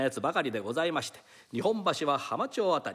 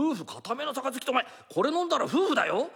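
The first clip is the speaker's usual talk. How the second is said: astonished; 7 semitones higher than usual. Acted. Thief stunned by the offer.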